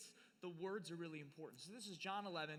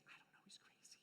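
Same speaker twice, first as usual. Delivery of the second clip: secret whisper, no voice; whispered aside; 20 dB quieter than usual. Retold or imitated. Thomas whispering to the others about Jesus. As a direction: whisper it behind his back.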